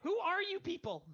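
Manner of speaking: high-pitched acted outcry; alarmed demand. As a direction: cry out at intruders in shock. Acted shock at strangers in house.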